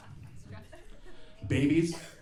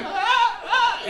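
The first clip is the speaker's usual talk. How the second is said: mock animal whimper; 10 dB louder than usual. Voicing a scared animal.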